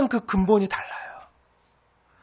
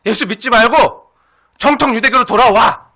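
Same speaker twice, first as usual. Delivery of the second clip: raised, forceful voice of a critic; acted scornful urging; 7 dB louder than usual. Critics urging believers back to Judaism, loud and insistent. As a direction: press them loudly to turn back. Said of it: "참수하고 조롱하고 비난하는".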